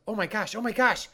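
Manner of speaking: high, loud mock panic; acted panicking mind. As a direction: play an alarmed mind gasping for air. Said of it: "Your mind automatically goes".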